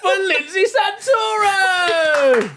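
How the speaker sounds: slow, drawn-out and loud, like a ring announcer; hyped announcer. Pretend. Introducing the guest to an imaginary crowd, drawn-out hype. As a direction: stretch the name out to hype the crowd.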